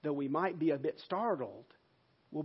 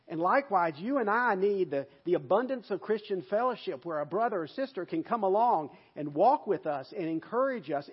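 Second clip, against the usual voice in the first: usual speaking voice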